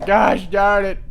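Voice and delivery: loud, high frustrated exclaim; exasperated outburst. Real, not acted. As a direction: blurt it out at the crash. Lander explodes on a hill.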